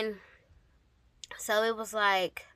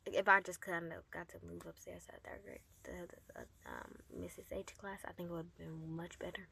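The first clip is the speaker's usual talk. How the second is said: trailing soft; 4 semitones lower, 17 dB quieter than usual. Real, not acted.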